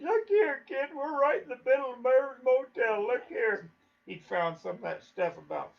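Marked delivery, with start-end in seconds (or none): 0.0-3.7 high, louder, jittery imitated voice; frightened urging. Hunter showing Dave the bear sign, in a high frightened imitated voice. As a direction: mimic a spooked man pointing it out.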